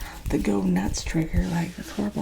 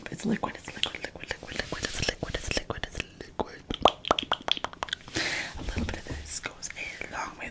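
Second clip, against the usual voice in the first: ASMR whisper; 7 dB quieter than usual. Real, not acted.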